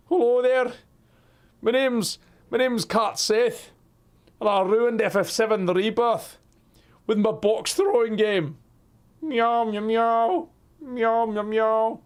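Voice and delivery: high, cutesy pretend cat voice; voicing the cat. Streamer makes his cat greet chat, in a high cutesy cat voice. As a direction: talk for the cat as if it introduces itself.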